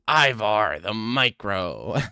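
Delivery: loud, slow, hammed-up title voice; mock grand name. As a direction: boom the name out slowly, mocking its grandeur. Hams up name, then laughs.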